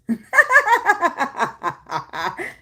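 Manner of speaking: high burst of giggles; giggling. Real, not acted. Laughing at her own stupid-ass remark, high giggling. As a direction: giggle helplessly, long and high. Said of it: "I'm giggling now".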